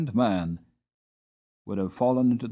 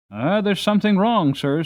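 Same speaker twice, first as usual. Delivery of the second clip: higher, louder worried call; alarmed groom; 7 semitones higher, 7 dB louder than usual. Acted. John calling to his master, higher and louder. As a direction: sound the alarm to your master.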